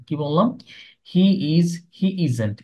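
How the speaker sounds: normal talking voice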